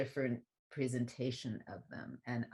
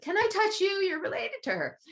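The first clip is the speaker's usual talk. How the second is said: much higher, louder, breathless, awestruck plea; imitating tearful fans; 5 dB louder than usual. Weeping attendees beg the speaker, imitated high and awestruck. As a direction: ham up the starstruck crying devotee. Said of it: "people were crying".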